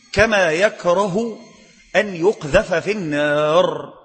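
slow, drawn-out sermon delivery; slow solemn preaching. Real, not acted. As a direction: linger over each phrase like a preacher. Slow deliberate preaching passage. (وعظ بطيء ومتأنٍ)

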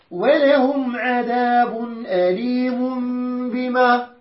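slow measured tajweed chant; Quran recitation. Shown as a demonstration. Teacher recites the verse to the class in slow tajweed. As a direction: draw each word out like tilawa.

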